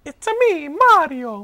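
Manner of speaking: very high, loud, slow cartoon Italian; Mario. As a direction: squeal it out like a video-game plumber. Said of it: "That's what he sounds like".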